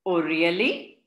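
teacher plays along with mock surprise. A narrator's reading. Teacher humours children with mock surprise. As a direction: fake a surprised oh really. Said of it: "pretending to be surprised".

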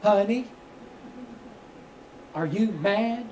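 ordinary talk, nothing marked

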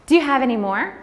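lightly voiced nosy lady; curious stranger. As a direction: ask innocently like a chatty shopper. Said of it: "I'm out in public again".

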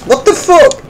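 high, loud disbelieving cry; startled high-pitched what; shocked outburst. Real, not acted. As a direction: yelp it in sudden shock. Sudden fall in game.

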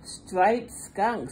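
plain reading voice

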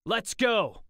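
rally the team with a yell; shout. Acted. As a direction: Jack charging in, loud high shout. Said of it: "Jack shouted".

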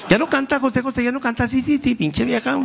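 high mocking gossip voice; mock her like a snide host; Pati Chapoy. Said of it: "Pati Chapoy dijo".

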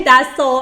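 loud, high, punched "does so"; emphatic self-correction. Real, not acted. Teacher rejecting her own mixed-up English, emphatic.

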